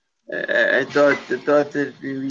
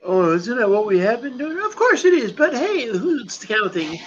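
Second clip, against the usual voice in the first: mock high voice; 9 semitones higher than usual. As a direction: pop up into a silly falsetto to tease. Mocking own chatter.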